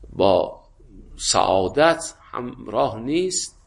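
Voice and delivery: ordinary talk, nothing marked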